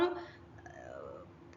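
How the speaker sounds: ഏർ said light and smooth, gliding away. Shown as a demonstration. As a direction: let the ഏർ slide off softly. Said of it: "കുറച്ച് light ആയിട്ട്". (ലൈറ്റ് ആയി smooth ഏർ)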